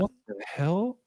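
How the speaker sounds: high, quiet disbelieving "What the hell?"; puzzled outrage at the rulebook; mock baffled exclamation. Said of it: "and I'm like".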